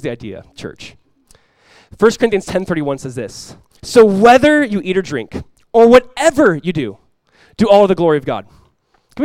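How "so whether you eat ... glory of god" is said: full-force preached verse; preaching the verse. Acted. Reads scripture at preaching force.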